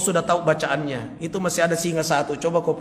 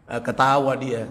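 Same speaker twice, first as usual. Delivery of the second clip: ordinary talk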